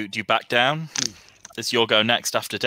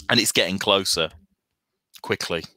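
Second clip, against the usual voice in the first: ordinary talk, nothing marked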